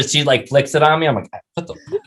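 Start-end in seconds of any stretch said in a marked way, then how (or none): 1.5-2.0 tiny high squeak of disbelief; mock shock. Diner getting holy water flicked at him, mock shocked. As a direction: squeak it softly, comically aghast.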